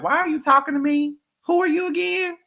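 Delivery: very high, mocking who-are-you voice; shrill mock indignation; sassy put-down. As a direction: look her up and down with your voice. Telling off Kayla in an acted retort.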